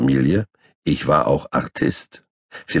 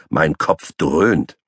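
ordinary delivery

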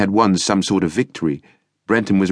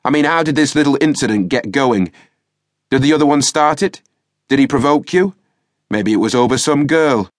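raised, higher, pressing questions; demanding sergeant; 6 semitones higher, 3 dB louder than usual. A narrator's reading. Sergeant grilling the young prisoner, demanding and loud. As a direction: push him for answers, voice up and louder. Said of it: "the sergeant demanded".

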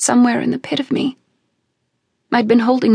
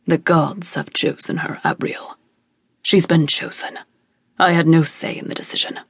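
low, heavy grave voice; father telling the weeping mother; stern father king; 5 semitones lower than usual. A narrator's reading. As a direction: speak like a solemn king refusing. Father figure speaking.

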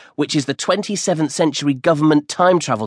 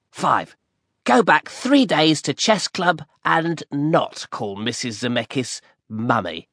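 cheeky best friend. Acted. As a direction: needle your friend with a cheeky reminder. Brian reads out his list.

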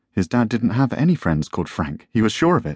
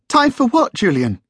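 much higher, tense woman's voice; tight, high-pitched; 4 dB louder than usual. A narrator's reading. Wife questioning Julian, tight high-pitched voice. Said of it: "her voice tight and high-pitched".

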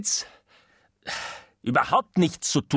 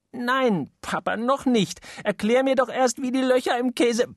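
put-on high pleading little boy; begging child; 10 semitones higher than usual. Little boy begging papa before bedtime, high child voice. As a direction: whine to stay up a bit longer.

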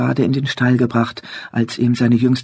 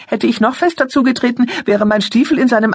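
put-on high, cocky bragging boy; boastful; 9 semitones higher than usual. A narrator's reading. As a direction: show off proudly. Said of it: "warf sich prahlerisch in Positur".

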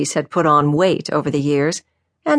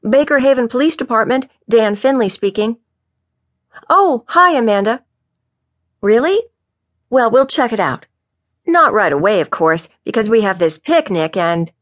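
higher, eager phone manner; chirpy officer on the phone; 6 semitones higher, 3 dB louder than usual. Acted. Officer Dan takes Amanda's call at the station, eagerly. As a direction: answer brightly as a keen junior officer.

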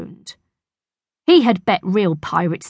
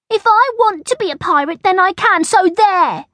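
put-on high, loud childish insistence; acted playground defiance, pitched high; defiant little girl; 14 semitones higher, 4 dB louder than usual. A narrator's reading. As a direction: stand your ground like a stubborn child. Angela defies Bertie.

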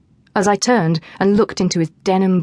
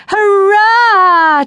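high, loud cheer of victory; girls cheering; 14 semitones higher, 8 dB louder than usual. A narrator's reading. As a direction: celebrate the win. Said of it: "cheered the girls".

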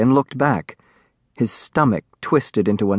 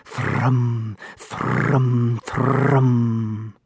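happy dragon thrum; 4 dB quieter, much slower than usual. A narrator's reading. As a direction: purr like a happy baby dragon. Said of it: "rubbed happily against him".

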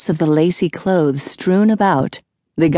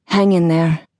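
ordinary delivery

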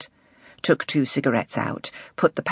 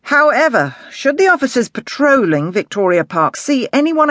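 lightly voiced commanding inspector, pitch raised; brief the officers firmly, a touch higher; detective inspector briefing; 5 semitones higher, 4 dB louder than usual.

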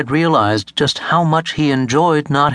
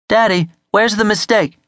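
lightly voiced child, higher pitch; higher-pitched questioning young son; child asking daddy; 10 semitones higher than usual. A narrator's reading. Boy asks his daddy a question, lightly voiced child.

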